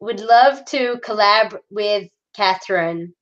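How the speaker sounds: put-on slow, forced American accent with heavy R's. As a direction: push the R's in a hammy American voice. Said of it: "That was horrible".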